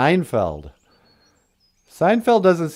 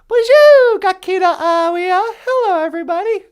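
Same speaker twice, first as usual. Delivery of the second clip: much higher, louder, fast; high excited lady greeting; 7 dB louder than usual. Pretend. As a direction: hype up the audience as the lady. [name] greets audience.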